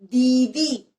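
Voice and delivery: दीदी loud, first vowel held long; forceful stress on दीदी. Shown as a demonstration. Teacher telling pupils to press the vowel, दीदी stressed hard. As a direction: punch and stretch दीदी. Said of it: "जोर दे के ही बोलना है".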